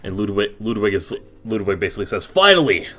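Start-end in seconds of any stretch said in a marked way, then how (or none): 2.2-2.9 high, loud burst; loud exasperated relief. Acted. Ludwig gets the information he needed, loud exasperated relief. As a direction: exclaim it like the wait is over.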